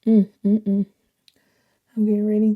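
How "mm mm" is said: ordinary talk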